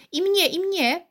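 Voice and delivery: put-on high, eager children's cries of "me too"; lightly voiced crowd clamouring; eager voices from the crowd. A narrator's reading. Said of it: "rozległy się głosy".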